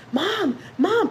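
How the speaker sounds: high frightened call for mom; a small boy waking from a nightmare, calling out high; retold child screaming. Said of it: "he would wake up screaming".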